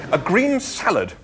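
high-pitched, firm insistence. A narrator's reading. Arthur refusing the offered liver, firm. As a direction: insist on it, firmly. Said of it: "said Arthur firmly".

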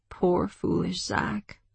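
slow, soft sorrow; hushed grief. A narrator's reading. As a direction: mourn him slowly under your breath. Grieving over dead Zack.

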